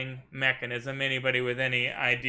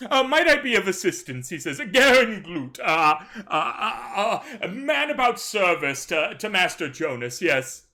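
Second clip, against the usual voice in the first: higher, louder, fussing servant; flustered butler guarding his master; fussy servant voice; 6 semitones higher, 4 dB louder than usual. Acted. Servant stopping the captain at the tent, fussy and flustered.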